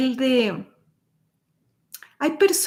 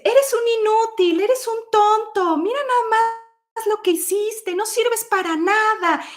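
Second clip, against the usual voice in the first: scolding parent; 10 semitones higher than usual. Pretend. A harsh mother berating her little son, mock angry.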